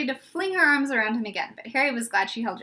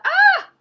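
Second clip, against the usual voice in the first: a piercing high scream of horror, much louder; shrill scream; 13 dB louder than usual. A narrator's reading. Hermione hearing what was under the turban, high loud scream. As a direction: let out a short high shriek. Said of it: "Hermione screamed out loud".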